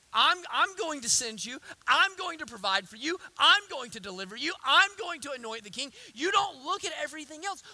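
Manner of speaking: forceful promises, each opening punched; impassioned preaching as God. Acted. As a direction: hammer the first word of each promise.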